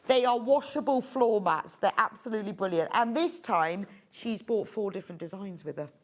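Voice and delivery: usual speaking voice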